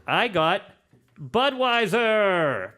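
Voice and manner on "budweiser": drawn out, high and loud; showy announcement. Real, not acted. Host reveals which beer he got, showy announcement. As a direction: announce it with a long flourish.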